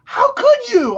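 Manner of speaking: high-pitched scandalized lady; mock outrage. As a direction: imitate a woman appalled at you. Shocked lady imitated.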